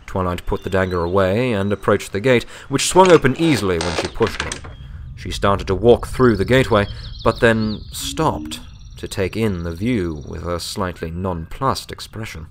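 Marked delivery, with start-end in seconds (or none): none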